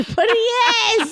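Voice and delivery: high, drawn-out giggly insistence; real helpless laugh in a high voice; laughing protest. Real, not acted. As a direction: insist through laughter, stretching the words. Defends crush while co-host teases.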